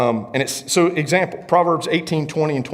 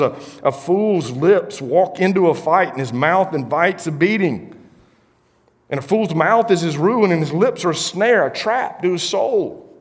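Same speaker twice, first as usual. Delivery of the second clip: scripture reading; 7 semitones higher, 3 dB louder than usual. Acted.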